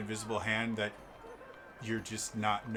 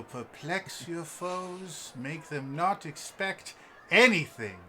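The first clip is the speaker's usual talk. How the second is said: showy pitch building louder and higher; theatrical elf shopkeeper; 4 semitones higher, 9 dB louder than usual. Acted. Elf promises chaos from the wand, grand loud flourish. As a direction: hype it up, swelling as you go. Game master voices wand seller.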